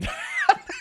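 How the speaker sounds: squealing high laugh. Real, not acted. Streamer laughing at his own joke, high squeal. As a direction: let out a high-pitched laugh.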